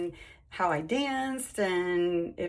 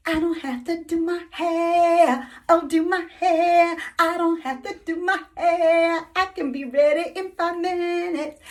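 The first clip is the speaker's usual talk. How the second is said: high, loud, almost singing with glee; giddy excitement; 8 semitones higher, 7 dB louder than usual. Real, not acted. Thrilled woman gushing to viewers about skipping hair, giddy and high.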